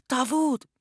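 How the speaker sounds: high, sharp call of his name; alarmed cry. A narrator's reading.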